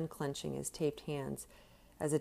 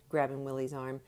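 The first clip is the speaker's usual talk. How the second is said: ordinary delivery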